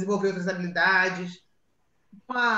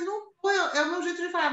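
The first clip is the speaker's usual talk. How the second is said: higher-pitched defensive retort, retold; defensive mother; 8 semitones higher than usual. Mother answering her son about her tone, defensive. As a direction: protest it higher, lightly acted. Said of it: "Eu falei".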